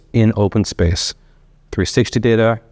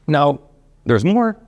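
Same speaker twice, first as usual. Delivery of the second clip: keynote reveal, pitch jumping much higher; polished corporate enthusiasm; 6 semitones higher, slower than usual. Acted. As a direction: tease the next big announcement. Keynote teasing the next announcement.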